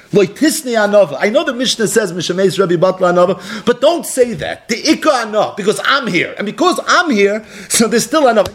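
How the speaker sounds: high-pitched, insistent sermon delivery; impassioned preaching. Acted. Preacher quotes a sage insisting humility still exists, impassioned. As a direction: preach the quote with rising urgency.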